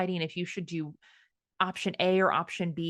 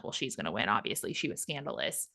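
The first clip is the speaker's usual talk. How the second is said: much lower and softer, conceding under her breath; resigned inner voice; 8 semitones lower, 7 dB quieter than usual. Retold or imitated. Thinking she's lost the costume contest, low and softer resigned aside. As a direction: mutter it like you've already lost the contest. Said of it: "and so I'm like".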